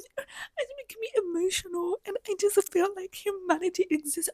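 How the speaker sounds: high, breathless mock-gushing voice; mocking gushing TikTok fan. Mocking TikTok viewers who gush over the moon trip. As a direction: parody a teary TikTok fan. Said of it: "people are falling for this propaganda on TikTok".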